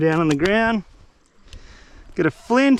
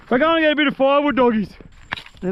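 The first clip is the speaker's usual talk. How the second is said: ordinary talk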